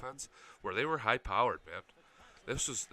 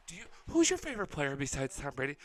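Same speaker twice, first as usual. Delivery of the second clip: higher, louder irritated confrontation; pissed off; 6 semitones higher, 6 dB louder than usual. Retold or imitated. Annoyed girlfriend challenging the Patriots fan, pissed off. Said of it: "she was pissed at this chick".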